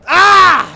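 sudden loud cry of triumph; excited yell. Real, not acted. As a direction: shout out in the heat of a firefight. Sudden excited shout mid-fight.

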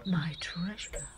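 soft whispered endearment; tender whisper. Acted. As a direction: breathe it to him.

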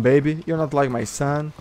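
usual speaking voice

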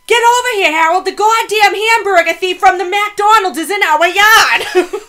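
high, loud Maine mother yelling; Maine accent. Retold or imitated. Mother yelling to Harold about the thief, high loud Maine accent. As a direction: holler it like a Maine mom. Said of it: "I love your Maine accent".

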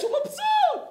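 put-on squeaky happy baby. Rabbi squealing about the happy naked baby. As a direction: squeal it like a pleased toddler.

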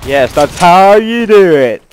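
slow, loud, drawn-out cheer; triumphant crowing. Real, not acted. Gamer echoing a game voice after a win, triumphant crowing.

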